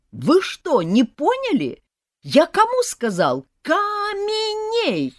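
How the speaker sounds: high, loud, with a long drawn-out last word; angry spell attempt; frustrated shout. A narrator's reading. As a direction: snake boy rages. Frustrated boy repeats spell.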